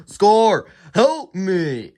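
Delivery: loud, high plea hanging from a cliff; desperate cry for help. Retold or imitated. Mufasa on the cliff crying up to his brother. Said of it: "he says".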